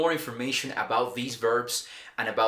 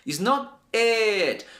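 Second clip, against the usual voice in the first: slow, higher and louder, each word spelled out; slow emphatic correction; 7 semitones higher, 3 dB louder, slower than usual. Shown as a demonstration. Teacher warning students about a wrong sound, slow emphasis. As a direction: stress the correction slowly so students catch it. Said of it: "Now pay attention".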